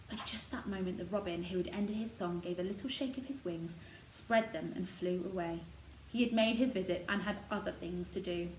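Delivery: plain reading voice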